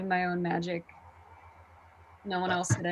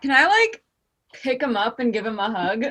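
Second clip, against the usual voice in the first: eager; 3 semitones higher, 5 dB louder than usual. Acted. Wants to hug healed friend.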